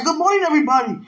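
high mimicked African accent; picked-up African accent. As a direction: greet everyone in a put-on African accent. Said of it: "I don't even talk like that".

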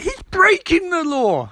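high, slow, outraged "breaking"; high incredulous. Retold or imitated. Ronnie retorting to Barry, high and slow in disbelief. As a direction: stretch it out in disbelief. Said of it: "And he's like".

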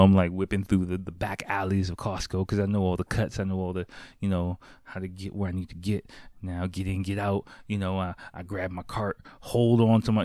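ordinary delivery

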